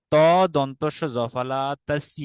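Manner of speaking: spelled out slowly, letter by letter; slow spelling. Shown as a demonstration. Teacher spelling the word slowly for students. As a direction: take each letter apart slowly. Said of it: "উচ্চারণটা কিরকম হবে?".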